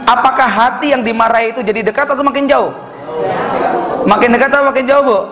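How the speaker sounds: impassioned preaching. Acted. Preacher asking the congregation about angry hearts, impassioned. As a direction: preach it up to the crowd, pitch lifted.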